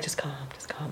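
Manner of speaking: soothing near-whisper, very soft; calm doula. Retold or imitated. Doula soothing the labouring mother, very soft and calm. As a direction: hush her gently like a calm doula. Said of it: "calm doula's trying to be like".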